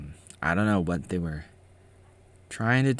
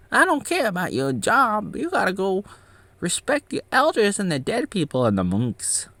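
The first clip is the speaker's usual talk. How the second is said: much higher put-on voice, scolding; squeaky mock monk telling him off; angry monk; 5 dB louder than usual. Upset monk scolding him for wearing shoes before the Buddha, high mock voice. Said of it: "he got so upset".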